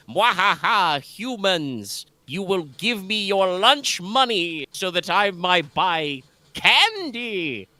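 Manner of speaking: high, slow bratty villain cackle; gloating little demon voice; sneering panto villain. Retold or imitated. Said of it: "but she's just like".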